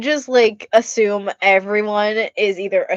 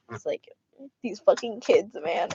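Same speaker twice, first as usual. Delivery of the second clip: normal talking voice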